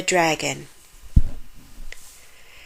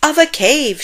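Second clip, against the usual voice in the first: higher, louder shout; 8 semitones higher, 4 dB louder than usual. A narrator's reading. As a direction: call it out triumphantly. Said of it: "shouted Grandfather".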